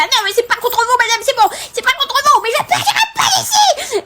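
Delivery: high-pitched, laughing protest, rising to a shout; high pleading protest. Retold or imitated. To the office lady, refusing to leave, high and pleading. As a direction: insist you won't leave, voice climbing.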